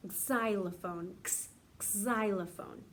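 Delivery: xylophone drawn out slowly, starting on a buzzing Z; xylophone, teacher sounding it out slowly with a clear Z; slow xylophone demo. Shown as a demonstration. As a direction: stretch out xylophone so the kids hear the Z. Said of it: "you kind of hear the Z a little bit more than the X".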